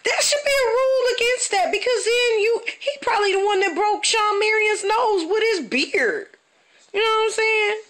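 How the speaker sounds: high-pitched exasperated whine; outraged fan ranting up high; worked-up rant. Real, not acted. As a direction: complain like it's a crime. Outraged rant about the beard.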